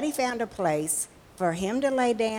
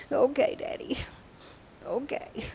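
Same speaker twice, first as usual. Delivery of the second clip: small high tearful voice; crying child; 6 semitones higher, 3 dB quieter than usual. Acted. Little girl answering her father at bedtime, still crying. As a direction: agree through tears, trailing softer. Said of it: "She was still crying".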